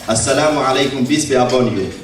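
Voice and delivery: normal talking voice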